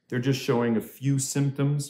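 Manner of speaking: usual speaking voice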